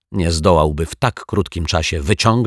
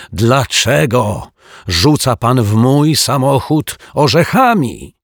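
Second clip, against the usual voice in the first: high, louder furious outburst; angry accusation; 7 semitones higher, 6 dB louder, slower than usual. Acted. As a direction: rage at him for the car. Angry caretaker accuses detective. (wściekły dozorca oskarża detektywa)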